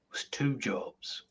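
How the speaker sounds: soft unvoiced whisper; soft conspiratorial whisper. Real, not acted. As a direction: whisper it aside to the table. Player whispers aside.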